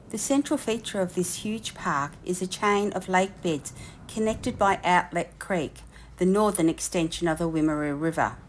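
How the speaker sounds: documentary narration read; voiceover narration. Acted. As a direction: narrate like a nature program.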